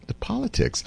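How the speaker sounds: stunned echo. Retold or imitated. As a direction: echo it back in quiet disbelief. Retold stunned reaction.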